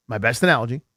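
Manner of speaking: normal talking voice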